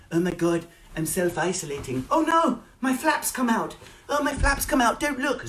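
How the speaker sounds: high mock squeal of fake modesty; hammed-up coy squeal; squealing influencer. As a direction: shriek like a coy influencer caught out. Mock influencer squealing about flaps.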